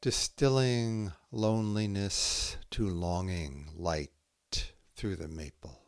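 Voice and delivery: slow, measured haiku reading with long pauses between poems; poetry reading. Acted. Poet reading her own haiku to listeners, slow and measured. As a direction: let each image land before the next.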